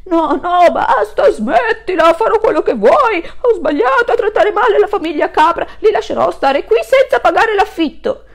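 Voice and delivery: bully begging the wolf to stop; begging landlord. Acted. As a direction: cry for mercy while spinning. Landlord begs while spun.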